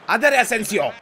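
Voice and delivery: loud, high cheer to the player; urging on. Real, not acted. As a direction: shout the player forward.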